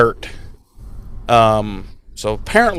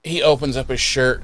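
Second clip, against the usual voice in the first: normal talking voice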